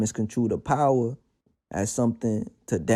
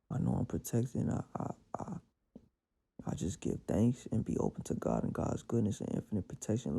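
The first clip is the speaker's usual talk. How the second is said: quiet prayer; 7 dB quieter than usual. Acted. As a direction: pray it under your breath, calm and grateful.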